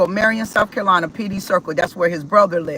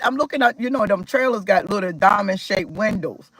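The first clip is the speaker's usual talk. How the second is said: ordinary delivery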